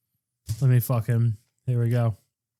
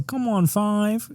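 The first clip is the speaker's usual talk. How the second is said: imitating coworker; 9 semitones higher, much slower than usual. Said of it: "and he would go".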